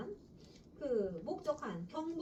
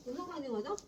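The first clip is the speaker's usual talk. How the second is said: ordinary talk, nothing marked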